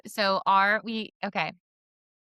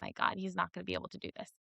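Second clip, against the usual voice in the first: fast, much softer thought rushed under the breath; doubting thought about her son; inner worry; 2 semitones lower, 10 dB quieter, much faster than usual. Retold or imitated. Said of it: "And immediately I'm like".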